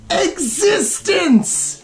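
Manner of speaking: drawn-out, high, echoing "Existence"; slow high echoed "Existence"; dramatic echo. Acted. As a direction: boom it out like a movie reveal. Echoing word for dramatic effect.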